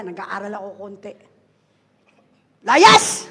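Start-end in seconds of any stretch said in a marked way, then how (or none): 2.6-3.3 loud, high one-word command; loud Bisaya-style command. Shown as a demonstration. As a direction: spit it out at the demon. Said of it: "sa Bisaya".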